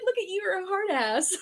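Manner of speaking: high-pitched teasing admiration; playful high-voiced amazement; mock impressed. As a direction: retell being impressed, about to crack up. Retells own impressed reaction, laughing.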